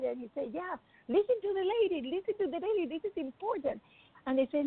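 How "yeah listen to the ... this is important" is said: high-pitched, insistent retold plea; urgent insistence. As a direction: urge the children to pay attention. Father urging his children, retold.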